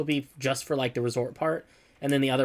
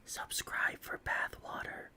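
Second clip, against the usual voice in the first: breathy slow whisper, softer; 13 dB quieter, much slower than usual. Pretend. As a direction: lean in and whisper it slowly. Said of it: "You got a good whisper voice".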